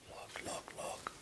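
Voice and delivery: hushed, breathy whisper of wonder. Real, not acted. Cook marveling at his corn, whispering to viewers. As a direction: lean in and whisper it like a secret.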